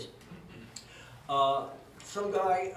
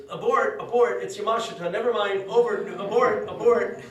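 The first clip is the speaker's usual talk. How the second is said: loud, raised imitation of calling off an attack; mock urgent command; 4 semitones higher, 10 dB louder than usual. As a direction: urgently call the retreat, hammed up. Rival's leader calls off the ambush.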